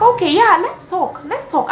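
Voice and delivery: acted high-pitched readiness to talk; mock eager agreement. Girlfriend agreeing to hear him out, high mock eagerness. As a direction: jump up high, loud on the first words.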